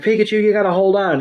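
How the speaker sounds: high, quick urgent plea; Ash. Retold or imitated. Ash urges captured Pikachu to hold on, high hurried plea. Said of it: "Ash is like".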